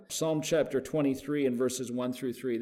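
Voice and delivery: ordinary delivery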